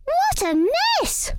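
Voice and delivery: high, slow dismayed mouse cry. Acted. Missus Mouse finds the messy squash, high dismayed cry. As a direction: exclaim in tiny dismay. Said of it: "she cried".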